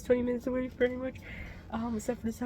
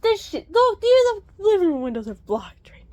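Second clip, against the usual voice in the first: loud annoyed rant; 7 semitones higher, 11 dB louder than usual. Real, not acted. Ranting about the blizzard.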